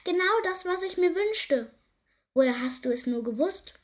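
high, delighted turtle thanking the mouse; bursting with joy at the gift; high happy cry; overjoyed. A narrator's reading. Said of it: "rief die Schildkröte".

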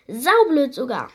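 squeaky creature. A narrator's reading. Tiny creature grumbles.

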